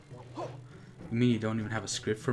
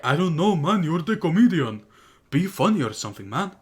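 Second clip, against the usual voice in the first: mock Ubisoft boss in a put-on accent, higher and louder; hammed-up accent for a lazy boss; a jokey put-on accent; 5 semitones higher, 5 dB louder than usual. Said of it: "I don't know why I gave him that accent".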